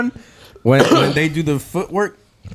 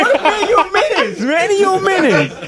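laughing; 13 semitones higher than usual. Real, not acted. Teasing friend, laughing.

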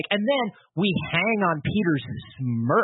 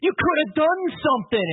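scolding cop; 10 semitones higher than usual. Acted. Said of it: "the cop going like".

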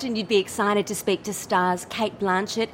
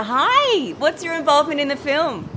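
bright, higher, louder eager greeting; gushing red carpet chatter; 5 semitones higher, 4 dB louder than usual. Acted. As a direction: pounce on the guest excitedly. Excited reporter greeting.

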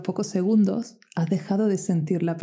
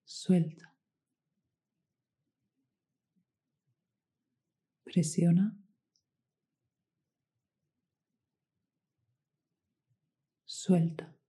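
whispered press and release cues; guided meditation. Acted.

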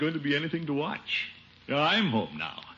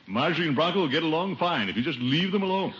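ordinary delivery

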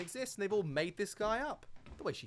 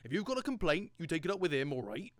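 pushy barman impression; 3 semitones higher, 7 dB louder than usual. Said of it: "That guy sure knew how to upsell".

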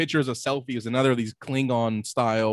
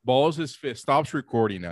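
normal talking voice

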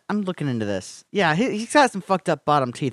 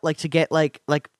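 ordinary talk

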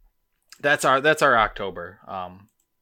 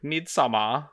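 Midsommar drawn out in a put-on Swedish accent; mock Swedish; 4 semitones higher, slower than usual. Shown as a demonstration. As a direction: say it as if Swedish, slow, rising at the end. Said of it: "pronounce it like I'm Swedish".

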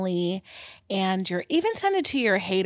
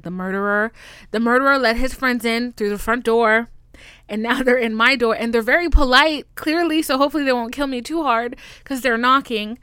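panicked rant; 3 semitones higher, 7 dB louder than usual. Retold or imitated. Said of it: "I was like".

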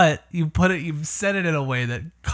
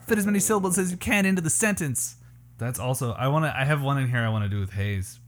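ordinary delivery

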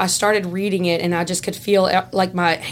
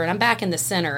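usual speaking voice

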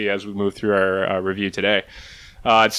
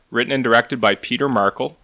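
usual speaking voice

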